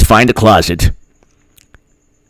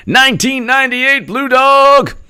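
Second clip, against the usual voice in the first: announcer voice. Acted. Mock announcement of username.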